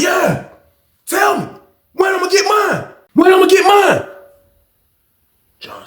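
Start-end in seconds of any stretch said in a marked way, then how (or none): 0.0-4.1 angry shout. Retold or imitated. Inmate demanding his money.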